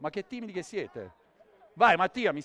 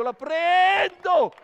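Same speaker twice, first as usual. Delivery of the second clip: high, loud, drawn-out shout; slow, soaring showman's holler; shouted catch call; 10 semitones higher, 11 dB louder, much slower than usual. Real, not acted. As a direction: stretch it into a big yell as you go for the catch. Performer shouts while making a catch.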